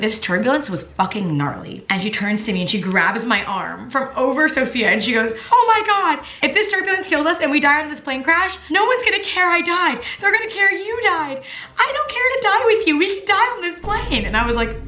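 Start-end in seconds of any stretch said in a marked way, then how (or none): 5.5-14.3 imitating panicked fan. Fan panicking about dying in the crash, mock-frantic and high. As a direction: squeal it like a terrified fan in turbulence. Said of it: "she was, like, freaking out".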